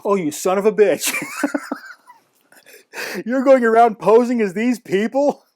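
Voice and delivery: high, loud delighted accusation broken by laughter; amused disbelief. Retold or imitated. Narrator realising the friend poses as concierges, amused outrage. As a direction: catch a friend out, incredulous and laughing.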